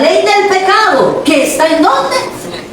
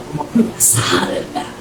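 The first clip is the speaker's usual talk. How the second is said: whisper; 8 dB quieter than usual. Real, not acted. Preacher confides to congregation.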